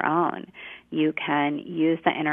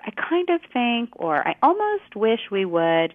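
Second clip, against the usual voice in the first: tentative; 6 semitones higher than usual. Shown as a demonstration. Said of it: "sometimes we say things like".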